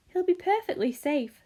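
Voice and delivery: ordinary delivery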